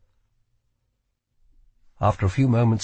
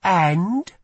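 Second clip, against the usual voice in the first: put-on young woman voice; 8 semitones higher, 3 dB louder than usual. Lila prods Kell.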